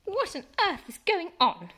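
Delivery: high, loud angry mum's demand; a mum roused at night, sharp and loud; hissed. Acted. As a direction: mum bursting in, demanding answers. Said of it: "she hissed".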